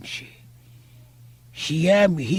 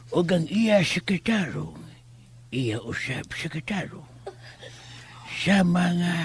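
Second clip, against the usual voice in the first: ordinary delivery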